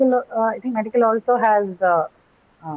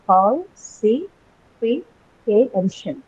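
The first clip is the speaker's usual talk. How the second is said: falsification drawn out very slowly, syllable by syllable; slow syllable demo; 2 semitones higher, much slower than usual. Shown as a demonstration. Teacher to students, falsification spelled out slowly. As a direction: stretch falsification out so each syllable is heard. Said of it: "teacher slows down for syllables".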